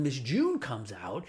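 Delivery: usual speaking voice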